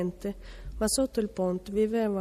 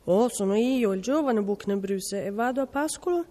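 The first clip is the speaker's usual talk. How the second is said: usual speaking voice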